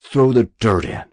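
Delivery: loud, fierce order; tigerish. A narrator's reading. Officer turning on his two privates, tigerish. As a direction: snarl the order at the men. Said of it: "tigerishly said".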